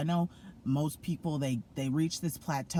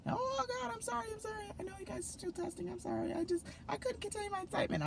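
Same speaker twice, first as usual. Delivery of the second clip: very high, softer, fast sorry-sorry voice; mock squeaky apology; 6 dB quieter, faster than usual. Classmates apologizing to test-takers, squeaky and quick. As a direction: gush apologies in a tiny squeak.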